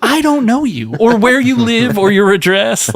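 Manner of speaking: high, exasperated retort; high, hammed-up frustrated reply to a pushy lady; mock exasperation. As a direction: squeal it in disbelief.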